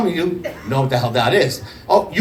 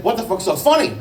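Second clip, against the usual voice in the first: higher-pitched baffled demand; exasperated demand; 7 semitones higher than usual. Retold or imitated. Comic retelling his question to his laughing friend, exasperated. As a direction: act out being bewildered by someone's laughing.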